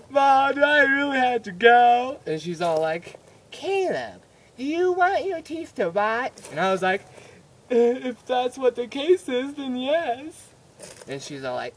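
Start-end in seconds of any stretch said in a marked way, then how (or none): 0.1-2.2 put-on high, loud kid whine; over-the-top reluctant whining; whiny pleading. Whining kid begging his mom. 3.5-6.3 high-pitched imitation of a scolding mother; mock motherly warning; scolding mom. Mom scolds him in retold quarrel. 7.7-10.5 put-on high, whiny kid comeback; mock sulky kid voice; whiny backtalk. Kid talks back to mom.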